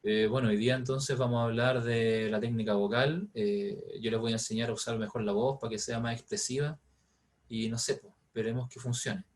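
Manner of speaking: low, soft, listless delivery; tired dull voice. Shown as a demonstration. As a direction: teach as if on a bad day. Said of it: "voy a estar haciendo la clase de esta forma".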